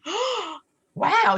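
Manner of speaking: thrilled surprise, pitched right up; surprised delight. Retold or imitated. Surprise at her phone call.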